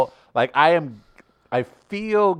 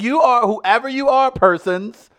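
amazed address; 10 semitones higher, 5 dB louder than usual. Retold or imitated. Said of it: "I was like".

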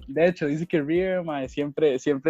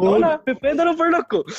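high, hammed-up sweet request; mock cheerful Tomasito at the bar; imitating Tomasito; 11 semitones higher than usual. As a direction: ask for a drink, overly sweet and high.

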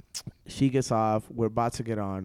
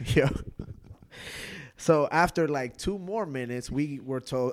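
ordinary talk

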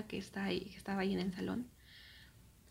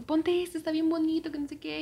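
higher, louder mock of an eager aunt; lively, high-pitched retold coaxing; eager urging; 8 semitones higher, 4 dB louder than usual. Aunt urging her niece to try on pants, eager urging.

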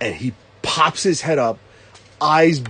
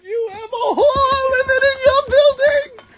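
high silly quote voice; 4 dB louder than usual. Retold or imitated. Movie quote impression.

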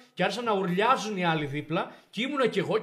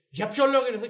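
louder, higher angry demand; angry father; 6 semitones higher, 6 dB louder than usual. Retold or imitated. Father bursting in on the principal, angry demand. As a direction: storm in and confront him.

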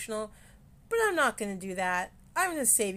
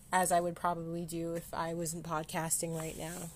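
ordinary talk

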